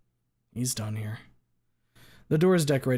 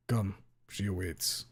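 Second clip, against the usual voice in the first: low, soft ominous Russian; quiet menacing Russian; Russian accent; 3 semitones lower, 5 dB quieter, slower than usual. Acted. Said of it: "Russian accent teacher".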